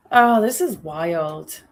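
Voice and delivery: ordinary talk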